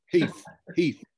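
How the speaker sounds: "Heath" said as "Heef". Shown as a demonstration. Teacher isolating the Cockney sound, "Heath" ending in F. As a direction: end "Heath" on an F, Cockney style. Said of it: "with an F".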